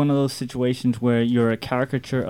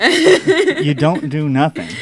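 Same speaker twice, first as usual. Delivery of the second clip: laughing; 5 semitones higher, 7 dB louder, much slower than usual. Real, not acted. Teasing guest, laughing.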